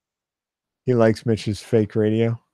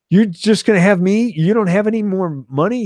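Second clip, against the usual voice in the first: incredulous; 9 semitones higher, 4 dB louder than usual. Retold or imitated. Kid shocked Carl is broke.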